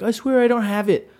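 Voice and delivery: high-pitched mock plea; imitating pleading. Little guy accused of stealing shoes, high imitated plea.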